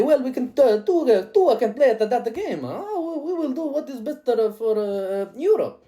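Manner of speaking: a defiant Europe, mock Italian flourish at the end; mock European. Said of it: "But the EU might turn around and say".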